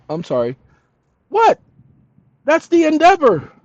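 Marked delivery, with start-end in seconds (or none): 1.3-3.5 sudden much higher, louder exclaim; surprised. Real, not acted. Hearing the Enterprise theme, surprised exclaim. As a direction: jolt of recognition.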